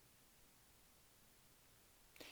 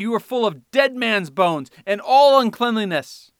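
shouted woes; 4 semitones higher, 9 dB louder than usual. Acted. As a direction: thunder down on the hypocrites. Preacher voicing Jesus' woes.

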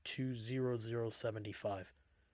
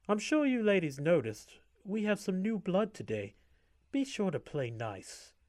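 sarcastic; 8 semitones higher, 5 dB louder than usual. A narrator's reading. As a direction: sneer it with fake sweetness. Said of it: "she said sarcastically".

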